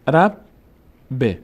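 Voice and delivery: "رب" closed on b, pause, then short "ب" with i vowel; doubled letter, split. Shown as a demonstration. Teacher shows the doubled b, in two separate syllables. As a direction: sound out "رب", stop, "ب". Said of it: "اولی ساکن، دومی کسره داشت".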